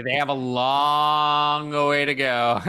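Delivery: "long" stretched right out, slowly; drawn-out LONG. Real, not acted. Podcaster on how far they must climb, drawn-out "long". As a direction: drag out the word "long" for emphasis. Stressing the big gap to Willis.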